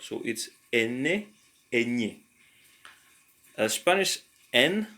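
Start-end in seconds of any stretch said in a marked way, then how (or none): 1.7-2.2 "eñe" with a ny sound, as in canyon. Shown as a demonstration. Teacher contrasts the two letters for students, palatal ny sound. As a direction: glide "eñe" through a ny sound, not a plain n. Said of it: "N with the tilde on top".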